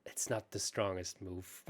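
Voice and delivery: low, soft, understated computer voice; calm computer. Retold or imitated. Imitating the chess computer after a blunder, very calm. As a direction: deliver it quietly, unbothered, like a machine. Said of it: "very calmly saying".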